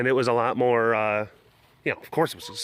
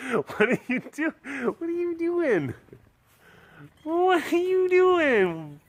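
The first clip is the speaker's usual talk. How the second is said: squealing pet talk; slower than usual. Real, not acted. Owner asks the cat what it's doing, high cooing squeal.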